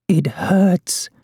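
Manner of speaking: put-on high, pained old woman's wail; frail high-voiced lament; quiet wail. A narrator's reading. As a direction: wail it softly, pitched high. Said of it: "she wailed quietly".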